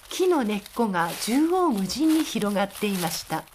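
ordinary talk, nothing marked

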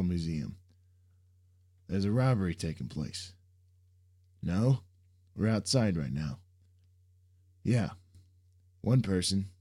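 reporting a robbery by phone; phone call voice. Acted.

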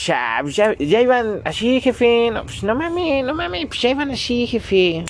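very high, put-on whine of a ñero; ñero street-guy impression. Podcaster imitates the guy who sold faulty earphones, whiny ñero voice. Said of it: "un güey bien ñero me dijo".

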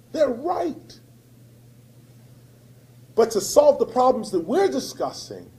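ordinary talk

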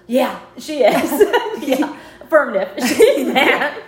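loud, high, laughing; aunt Peggy agreeing while laughing loudly; laughing confirmation. Retold or imitated. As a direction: laugh out the yes.

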